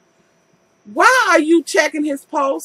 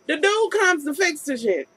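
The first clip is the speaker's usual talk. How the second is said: ordinary talk, nothing marked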